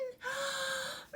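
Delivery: high theatrical gasp; dramatic gasp. Pretend. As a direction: gasp at the disaster. Second math emergency.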